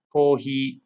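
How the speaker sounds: "kohi" said the Japanese way, ko-hi, slowly; Japanese loanword. Shown as a demonstration. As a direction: sound out "kohi" for learners. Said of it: "which is coffee".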